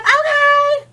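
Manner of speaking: high squeaky mock agreement; a high, pushed-over okay; sarcastic okay. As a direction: squeal a fake okay, giving in. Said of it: "And I'm like".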